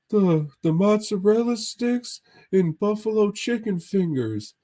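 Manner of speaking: squeaky goofball ordering food; Mahoney impression. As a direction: ham up your buddy ordering, way up high. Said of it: "It's like".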